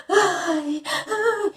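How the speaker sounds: high, drawn-out mock gasp for air; imitated sobbing gasps. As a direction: imitate sobbing until out of breath. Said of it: "de, tipo, soluçar de chorar". (imitação de soluço de choro, sem ar)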